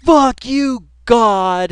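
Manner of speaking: high, loud shout at the sky; mock defiant yell. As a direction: yell it up at the heavens, hammed-up. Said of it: "He is just".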